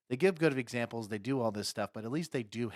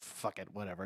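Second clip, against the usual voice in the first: quiet, resigned; 5 semitones lower, 8 dB quieter than usual. Retold or imitated. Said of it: "he's like".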